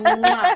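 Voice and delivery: loud smacking air kiss, high and laughing; playful kiss sound; blown kiss. Real, not acted. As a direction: blow a big kiss to her. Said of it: "send you a bunch of kisses".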